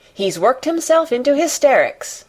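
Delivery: urgent, alarmed announcement; hurried alarm. A narrator's reading. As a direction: blurt out the bad news. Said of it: "she said in a great hurry".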